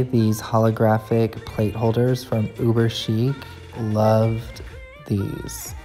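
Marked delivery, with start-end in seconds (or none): none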